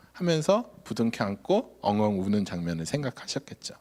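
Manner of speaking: ordinary talk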